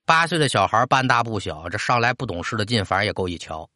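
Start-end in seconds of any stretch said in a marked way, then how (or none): none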